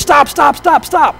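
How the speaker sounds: high, loud alarmed cry of stop; urgent shout. Retold or imitated. Wife shouting at her husband to stop the car, alarmed yell. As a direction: yell it like you need the car halted now.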